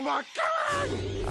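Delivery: high, slow manic shout; manic rant. Acted. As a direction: cry it out in bloodlust, drawn out. Villain ranting about killing.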